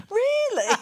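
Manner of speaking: very high, loud mock amazement; retold disbelief. Customer falls for the Saturday job joke, retold disbelief. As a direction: squeal it like a gullible customer.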